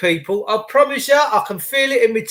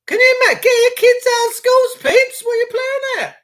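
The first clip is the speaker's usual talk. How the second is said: very high-pitched, incredulous screech; outraged yelling; 3 dB louder than usual. Real, not acted. Host urging parents to act, outraged high yelling. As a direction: yell at the top of your range in disbelief.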